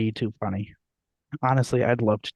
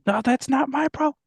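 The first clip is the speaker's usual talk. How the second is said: high-pitched acted movie quote; put-on high voice, quoting a character; imitated movie character. Acting out an Up scene for a friend, high imitation.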